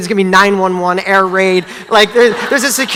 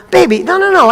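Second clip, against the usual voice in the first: reassuring; 9 semitones higher than usual. Retold or imitated. Soothing crying daughter.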